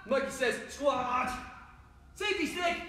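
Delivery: hurried commands, rising high. Shown as a demonstration. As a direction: race through the shapes in a high voice. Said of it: "do it a little bit faster".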